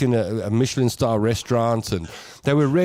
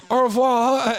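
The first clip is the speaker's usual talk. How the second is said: Au revoir said higher, French r, with a laugh; proud French goodbye; 11 semitones higher, slower than usual. Retold or imitated. Host saying goodbye to French-speaking guards, laughing and French. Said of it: "I go".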